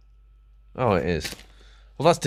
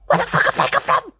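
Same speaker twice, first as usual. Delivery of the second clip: far higher and louder shriek; outraged squeal; 5 dB louder than usual. Real, not acted. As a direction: shriek in disbelief. Outraged at mystery hairs.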